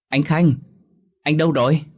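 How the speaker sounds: high, loud anxious call. A narrator's reading. Pregnant wife searching the room for her husband, anxious calling. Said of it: "mà gọi".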